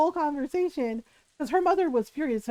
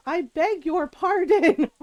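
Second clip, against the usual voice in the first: slow, louder, higher affronted pardon; offended vice principal; 5 semitones higher, 6 dB louder, slower than usual. Retold or imitated. Vice principal offended by the slur, slow affronted mock outrage. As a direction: draw it out like an outraged official. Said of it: "the vice principal goes".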